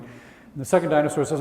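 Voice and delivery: usual speaking voice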